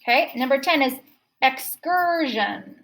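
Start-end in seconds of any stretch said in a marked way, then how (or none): 1.4-2.8 EXCURSION drawn out slowly, syllable by syllable; slow teaching EXCURSION with long er; sounded out. Shown as a demonstration. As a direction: say EXCURSION slowly as ex-CUR-zhun. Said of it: "So we've got e".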